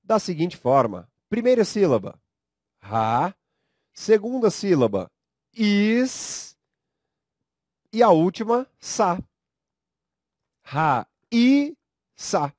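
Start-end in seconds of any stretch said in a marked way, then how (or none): 1.3-12.5 ra, is, sa spelled out with pauses and the is pitched high; syllable by syllable. Shown as a demonstration. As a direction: separate raíça slowly into ra, is, sa. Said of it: "podemos separar a sílaba".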